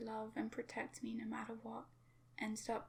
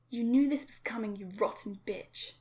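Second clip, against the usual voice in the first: raging scream; 11 dB louder than usual. Acted. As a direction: spit the insult with full rage. Father beating daughter.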